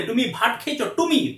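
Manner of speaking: imitated foreign learner of Bengali; mock foreign accent in Bengali; foreigner's accented Bengali. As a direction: say it in Bengali with foreign-sounding consonants. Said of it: "কোনো foreigner এরকম করে বাংলা শিখালে".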